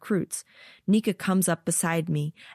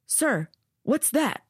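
curious soldier; 8 semitones higher, slower than usual. Acted. Soldier noticing something.

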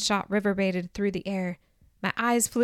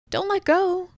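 panicked high cry, much higher; alarmed; 10 semitones higher, 4 dB louder than usual. Acted. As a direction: plead in sudden fear. Sam lets go mid-flight.

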